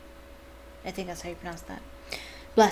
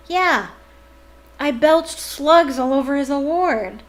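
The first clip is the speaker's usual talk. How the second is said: slow, loud, higher complaining; disgusted Ron; 6 semitones higher, 8 dB louder, slower than usual. Acted. Boy complaining to Harry about slugs, slow loud disgust. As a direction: drag it out in disgust. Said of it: "voicing Ron, outraged".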